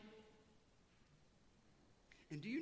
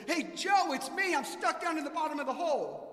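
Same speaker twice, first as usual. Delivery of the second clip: much higher and louder shout up; calling to a friend; 9 semitones higher, 10 dB louder than usual. Acted. Man in a hole shouts up to his buddy Joe: much higher, louder. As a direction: holler up to the buddy. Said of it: "the guy in the bottom of the hole says".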